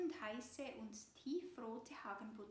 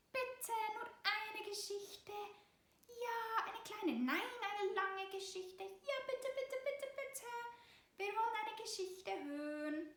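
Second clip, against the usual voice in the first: put-on begging mouse children; 9 semitones higher, 3 dB louder than usual. A narrator's reading. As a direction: beg all at once like mice. Mouse children beg together. (hohe, aufgeregt bettelnde Mäusestimmen)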